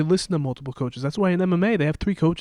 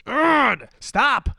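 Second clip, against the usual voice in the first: high, annoyed groan to stop; mock exasperation; 8 semitones higher, 4 dB louder than usual. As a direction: act out being fed up with a yelling coach. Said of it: "when you yell something out, I'm like".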